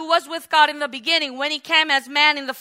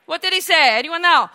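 normal talking voice

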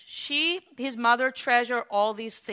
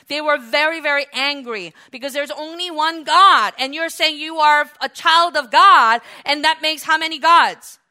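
loud, raised sermon voice; forceful preaching; 5 semitones higher, 9 dB louder than usual. Real, not acted. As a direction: press the argument hard on the listeners.

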